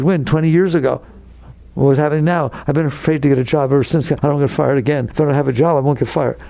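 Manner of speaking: normal talking voice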